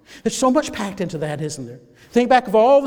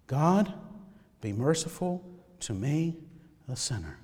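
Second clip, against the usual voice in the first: low, hushed plea; soft prayer; 6 semitones lower, 8 dB quieter than usual. Shown as a demonstration. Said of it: "You need a prayer to pray".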